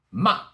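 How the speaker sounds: MA short and loud, falling from high; fourth tone. Shown as a demonstration. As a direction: chop MA down like a karate chop. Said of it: "Let's review one more time".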